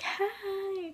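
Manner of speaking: high, drawn-out hi; retold burst-in greeting. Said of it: "burst into his room like".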